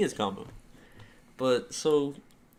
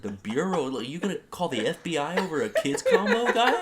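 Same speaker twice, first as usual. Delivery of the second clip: louder, higher incredulous retort through laughter; laughing disbelief; 6 semitones higher, 6 dB louder than usual. Retold or imitated. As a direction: laugh at the absurd threat. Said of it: "I was like".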